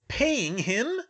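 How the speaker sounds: loud, high incredulous question; outraged disbelief. A narrator's reading. Malfoy hearing of the elf's wages, loud outraged disbelief. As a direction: echo it back, appalled.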